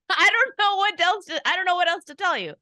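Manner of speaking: high, loud, exasperated protest. Real, not acted. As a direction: throw it out in helpless exasperation. Defending herself over forced picks.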